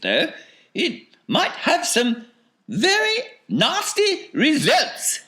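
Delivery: very high eccentric falsetto; flustered Wonka. A narrator's reading. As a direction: think aloud in a piping voice, doubt turning to certainty.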